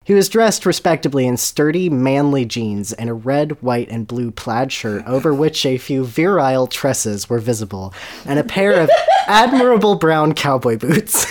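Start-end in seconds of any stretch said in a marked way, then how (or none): none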